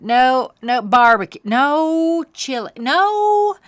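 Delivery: rising loud whiny no's, slowing down; fussy refusal. Acted. Cameron rejecting every food stand, whiny and rising. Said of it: "Cameron changed his mind".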